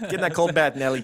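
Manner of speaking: put-on loud, high New York accent; hammed-up New York bossing. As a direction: order her in mock New Yorkese. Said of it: "We didn't do any New York accents".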